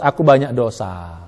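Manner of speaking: rendah, pelan dan lambat; lirih menyesal. Pretend. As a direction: akui dosa dengan suara lirih. Memerankan jamaah yang menyesal. (pengakuan dosa yang lirih)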